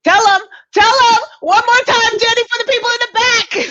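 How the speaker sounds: high, loud hype-woman shout; calling out. Pretend. Host cheering guest on for people in the back, calling out. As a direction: holler it out in support of the guest.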